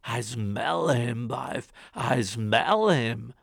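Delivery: a suspicious giant growling to his wife; ogre sniffing. Acted. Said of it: "the ogre".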